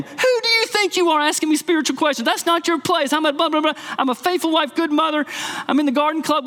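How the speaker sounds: high-pitched, indignant mock yell; worked-up, high-pitched telling-off; angry mother. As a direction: act out a mother scolding in outrage. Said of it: "the mother got angry. She yelled at him".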